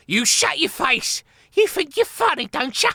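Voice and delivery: high, loud squeaky fury; furious rat. A narrator's reading. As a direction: spit it out in rage. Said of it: "a picture of fury".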